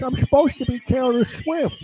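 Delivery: fake [name]. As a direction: put on a bad girly voice. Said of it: "He goes".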